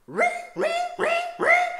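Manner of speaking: shrieking very high, loud stabbing screech; Psycho shriek. Retold or imitated. Babysitter mimicking the Psycho shower-scene screech at the kids. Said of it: "and I would go".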